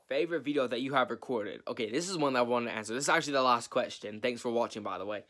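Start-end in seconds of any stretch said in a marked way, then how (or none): none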